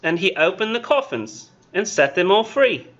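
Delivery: put-on slow British child's recitation with lilting pitch; British kid voice. Teacher finishes Blake's poem for the class, British kid voice. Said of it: "Do y'all want the British kid voice?".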